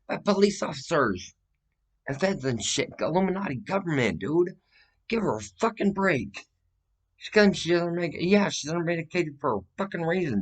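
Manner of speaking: loud, high-pitched agitated outburst; worked-up, indignant tirade; angry rant. Real, not acted. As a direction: vent in outrage, pitch jumping up high. Agitated rant about family being wronged.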